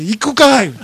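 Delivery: loud, high comic comeback; indignant retort. Retold or imitated. Customer from far away rejects returning to this store, indignant. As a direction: shoot back in mock outrage.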